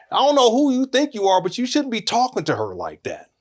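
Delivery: high-pitched indignant comeback, easing down; indignant mock challenge, starting high; retold confrontation. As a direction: act out standing up to a rude teacher. Retold standing up to rude teacher.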